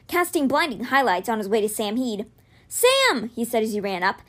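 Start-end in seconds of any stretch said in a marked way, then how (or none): 2.7-3.3 high-pitched call to a friend. A narrator's reading. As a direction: call his name urgently. Calling friend while running up.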